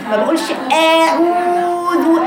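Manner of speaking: أعوذ stretched slow and sung with exaggeration; mock overdone. Shown as a demonstration. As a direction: sing out أعوذ in a mocking overdone way. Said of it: "بدون تكلف".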